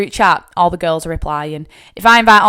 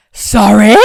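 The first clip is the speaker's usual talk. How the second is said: shocked sorry; 3 semitones higher, 9 dB louder than usual. Retold or imitated. Shocked reaction at car boot.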